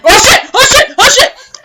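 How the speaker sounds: high, loud, frantic repeated curse; panicked cursing. Acted. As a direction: panic as the mount drops. Guard panics as eagle falls.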